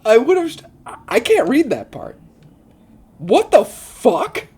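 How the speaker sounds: high, loud, flustered outburst; appalled disbelief. Real, not acted. Reader can't read that part, appalled disbelief.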